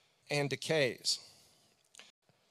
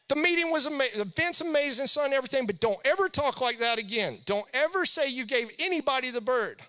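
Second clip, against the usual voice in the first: higher, louder stern father imitation; imitating scolding dad; 9 semitones higher, 5 dB louder than usual. Dad on the phone scolding son about stage talk, mock stern. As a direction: scold your son like a proper Southern dad. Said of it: "He called me".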